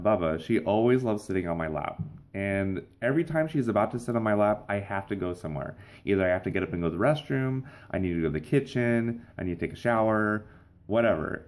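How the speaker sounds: ordinary talk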